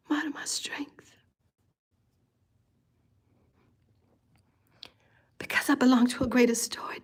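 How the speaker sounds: softly whispered inner truth. Real, not acted. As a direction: let it drop to a hushed confession. Intimate spiritual confession.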